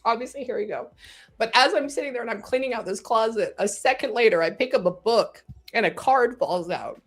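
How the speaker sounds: real welling-up, voice climbing high; getting emotional. Real, not acted. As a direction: tell it through rising emotion, pitched high. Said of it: "obviously I'm getting emotional".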